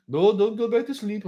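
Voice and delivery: high, loud put-on voice; hammed-up high-pitched cabbie; cab driver waking passenger. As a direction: urge a dozer awake, high and loud.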